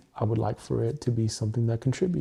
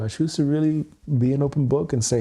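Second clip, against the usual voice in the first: usual speaking voice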